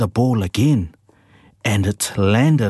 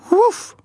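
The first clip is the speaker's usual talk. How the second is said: high yappy bark; a bright little yip; dog bark; 3 dB louder than usual. A narrator's reading. Rocky barks back at Mika, high and eager. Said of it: "barked Rocky".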